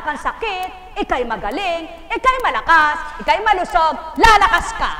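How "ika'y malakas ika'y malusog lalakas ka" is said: building from strong to full shout; rising preacher shout. Real, not acted. Preacher to congregation, building to a full shout.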